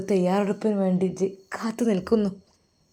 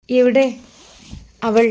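urgent call; 4 semitones higher, 7 dB louder than usual. A narrator's reading. Nurse bursts in asking.

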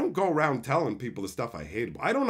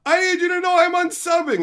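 much higher, louder mock whine; mocking viewer; 14 semitones higher, 9 dB louder than usual. Streamer mocking viewers announcing unsubs, high loud whine. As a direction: sneer it like a needy quitter. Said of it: "people are like".

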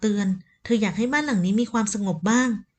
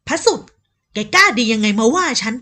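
furious scolding; 6 dB louder than usual. Acted. Elder wife scolds her husband's son, furious and loud. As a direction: tear into the insolent boy.

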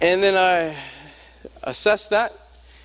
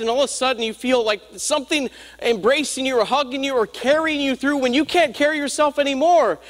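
raised, fervent preacher's delivery; preaching; 8 semitones higher than usual. Acted. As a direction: preach it higher, building to the congregation.

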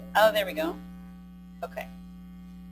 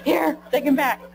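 laughing hand-back; 7 semitones higher, 5 dB louder than usual. Pretend. Mock parent handing back crying baby.

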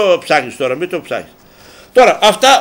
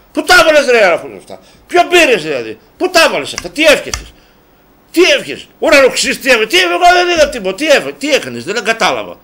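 high-pitched, loud, disbelieving questions; incredulous grilling; 6 semitones higher, 3 dB louder than usual. Acted. Host mock-grilling Alafouzos about where he put the money, incredulous. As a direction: fire off indignant questions.

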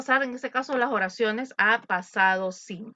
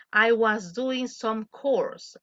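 slow model line; much slower than usual. Shown as a demonstration. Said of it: "model dialogue".

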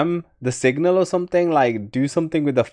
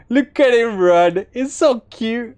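cooing; 11 semitones higher, 3 dB louder than usual. Real, not acted. Cooing over running kid.

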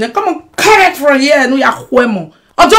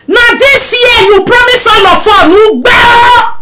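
shouting; 8 semitones higher, slower than usual. Acted. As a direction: yell it at her, accusing. Shouting at Mama B.

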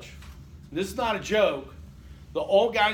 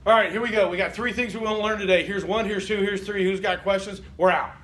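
loud, fast, get-it-done teacher; a loud, brisk professor rattling through the lesson; brisk no-nonsense professor; 2 semitones higher, 5 dB louder than usual. Retold or imitated. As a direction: bark it out like a hurried lecturer. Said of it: "the ones that were just like".

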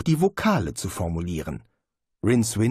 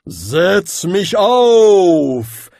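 enticing ancient hat; 9 semitones higher, 11 dB louder, much slower than usual. A narrator's reading. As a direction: lure him in, slow and ancient. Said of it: "sagte der Hut betörend".

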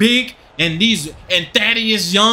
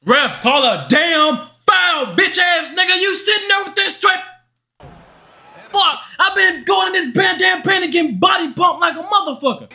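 furious yelling at the ref; 9 semitones higher than usual. Real, not acted. Gamer yelling at the referee over missed fouls, furious shout. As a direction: scream at the ref like you've been robbed. Raging at ref, no foul calls.

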